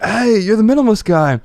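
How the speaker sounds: teasing stranger. Retold or imitated. Stranger at the airport ribbing the minimalist, mock smug voice. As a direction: tease him like a smug guy at the airport.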